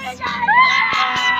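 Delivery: high, loud, slow shrieks; drawn-out panicky yelps; real panicked screaming. Real, not acted. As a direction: scream in alarm as the ride moves. Rider panics on the ride.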